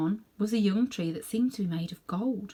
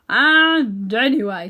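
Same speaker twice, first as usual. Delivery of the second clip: loud, very slow gruff drawl; hammed-up warthog; 3 semitones higher, 12 dB louder, much slower than usual. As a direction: boom it like a know-it-all warthog. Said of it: "said the warthog".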